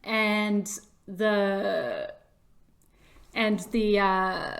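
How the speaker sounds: ordinary delivery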